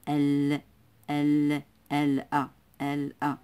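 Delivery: ال repeated alone with a clearly held L; lam pronounced. Shown as a demonstration. Teacher showing the L is spoken, ال broken off syllable by syllable. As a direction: sound out ال, then join it to أ. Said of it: "فإننا ننطق اللام".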